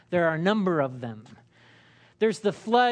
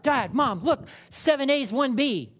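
put-on eager kid; 6 semitones higher, 3 dB louder than usual. Child showing report card.

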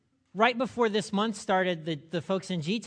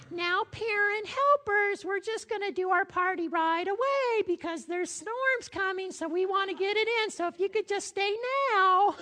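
sing-song teacher voice. Retold or imitated. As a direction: imitate a sweet preschool teacher rushing the parents. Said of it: "in her sing-song voice".

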